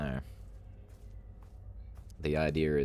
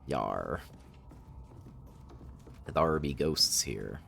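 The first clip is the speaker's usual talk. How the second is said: put-on pirate accent; much slower than usual. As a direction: ham up a pirate. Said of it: "It's not Talk Like a Pirate Day".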